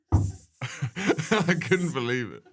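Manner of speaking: laughing through the words, slowly; giggling too hard to get it out. Real, not acted. Guest laughing while telling the host his childhood film story.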